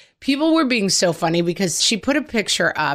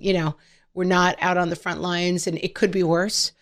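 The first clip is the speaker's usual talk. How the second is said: ordinary talk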